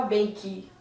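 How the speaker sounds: bake with long A and final K sound; model word. Shown as a demonstration. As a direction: say bake as bejk, second vowel silent. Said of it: "nós não falamos ela".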